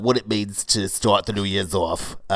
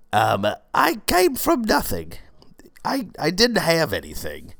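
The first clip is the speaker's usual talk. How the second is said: high-pitched, emotional hard-luck confession; getting emotional; 8 semitones higher than usual. Pretend. Yoga teacher telling a humble backstory, getting emotional. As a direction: play up the emotion, voice climbing high. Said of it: "I'm getting a little emotional".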